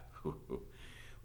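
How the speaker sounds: soft, low dismissive laugh; sheriff's chuckle. A narrator's reading. Sheriff mocking the teacher's protest, chuckling. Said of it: "The sheriff laughed".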